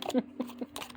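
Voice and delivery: quiet, breathy chuckle; short real laugh under the breath; soft giggle. Real, not acted. Reviewer smitten with the cute deck, soft giggle.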